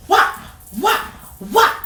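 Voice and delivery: sharp high whop whop noises; high snappy whip sounds; whop sound effect. Retold or imitated. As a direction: mimic the hanger swinging down. Imitating the hanger hitting.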